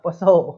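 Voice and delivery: 'पसो' loud, as a baffled misreading with P; wrong pronunciation. Shown as a demonstration. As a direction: punch out 'पसो' with the P voiced. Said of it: "अरे क्या पढ़ते हो". ('पसो' को P के साथ ज़ोर से गलत पढ़ना)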